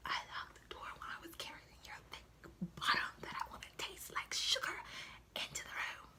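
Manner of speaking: soft, breathy secret whisper. Pretend. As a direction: breathe it like a naughty secret line. Invented aside in a hushed voice.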